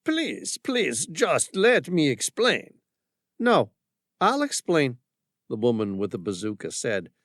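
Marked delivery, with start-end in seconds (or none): 0.0-2.8 high, slow begging French voice; French accent. Acted. Frenchman begs after warning shot. 3.4-5.0 higher, louder cutting-off; armed woman interrupting the commander; firm woman. Acted. Woman cuts him off.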